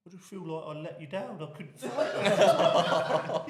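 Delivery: a half-laughed tearful confession; mock tearful upset. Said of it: "he was crying and that".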